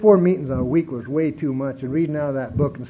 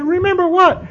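much higher and louder, puzzled; puzzled reply; 13 semitones higher, 8 dB louder than usual. Retold or imitated. Answering his sister, baffled and loud. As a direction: toss it back, baffled.

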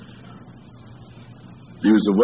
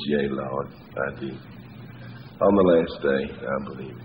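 low, hushed, submissive avowal; humbled, sarcasm gone; 8 semitones lower, 7 dB quieter than usual. Acted. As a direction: let all the arrogance drain out, sinking softer to the end. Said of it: "all the sarcasm, all the arrogance is out of Martha".

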